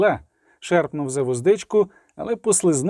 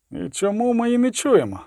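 higher-pitched wondering question; puzzled musing; 6 semitones higher than usual. A narrator's reading. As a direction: wonder aloud, mildly puzzled. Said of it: "задумався Ольгарсгайм". (здивований роздум уголос)

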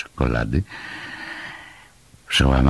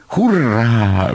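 high, drawn-out cheer; jubilant shout; 7 semitones higher, 4 dB louder than usual. A narrator's reading. Moomin greeting the sun after rain, jubilant shout. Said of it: "wykrzyknął Muminek".

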